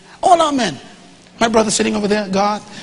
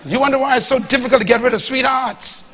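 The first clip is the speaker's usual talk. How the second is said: ordinary delivery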